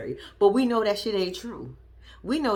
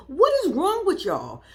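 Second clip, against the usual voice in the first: higher, louder exasperated cry; exasperated outburst; 8 semitones higher, 4 dB louder than usual. Real, not acted. Reacting to the family, an exasperated louder cry. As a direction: throw it at them in disbelief.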